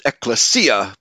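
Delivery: "ecclesia" as ek-luh-SEE-uh; Greek pronunciation. Shown as a demonstration. Host showing the Greek way, "ecclesia" stressed on SEE. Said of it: "putting the accent on the third syllable".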